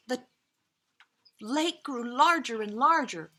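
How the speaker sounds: ordinary delivery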